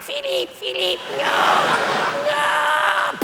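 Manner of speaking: high, thin mock cries of an old lady; hammed-up royal cry for help; the Queen crying for help. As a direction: squeal for Philip like a frail old queen. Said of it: "Like that".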